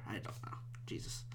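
whispered, barely voiced aside; under his breath. Real, not acted. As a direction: mutter it to yourself, very softly. Muttering to himself after being heckled.

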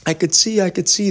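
imitating a boy overjoyed to see again; mock joyful yelling. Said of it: "He's yelling".

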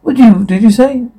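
distracted huh. Roth, distracted by the waiter, asks what she said, mildly startled. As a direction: snap out of a daydream, a touch surprised. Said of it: "Roth was distracted".

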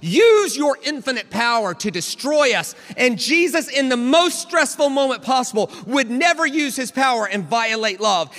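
high, loud preacher's proclamation; impassioned preaching. Real, not acted. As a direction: build into full-force preaching. Preaches at full force.

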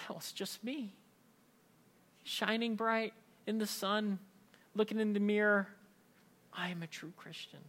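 a lightly mocking preen at the mirror; smug dreamy self-praise. Pastor mocking the smug believer, softly and dreamily.

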